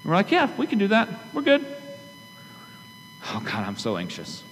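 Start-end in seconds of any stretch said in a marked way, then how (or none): 0.3-1.6 high-pitched, airy mock assurance; imitating easy, naive agreement; breezy overconfidence. Congregation's breezy overconfident reply, acted. 3.3-4.4 low, hushed mock worry; imitating an anxious sigh of a prayer; acted anxiety. Acting the anxious believer after confidence.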